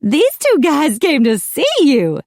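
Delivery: high, loud melodramatic upset; tearful tattling. A narrator's reading. Macy reports the guests to Apollo, high and tearful. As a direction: whine it with a trembling lip. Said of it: "his bottom lip trembled".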